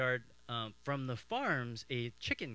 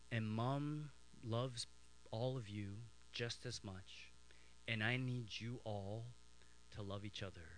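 tender motherly appeal; 3 semitones lower, 10 dB quieter than usual. Retold or imitated. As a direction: gently appeal to the children, pausing often. Mom's tender talk to her children.

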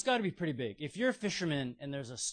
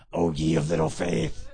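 much lower, louder playful teasing; teasing Jesus; 10 semitones lower, 10 dB louder than usual. Retold or imitated. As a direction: tease him like giving a noogie. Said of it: "I kind of picture him giving Peter a noogie".

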